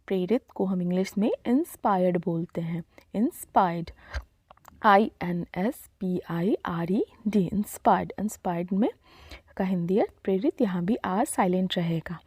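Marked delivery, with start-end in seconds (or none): none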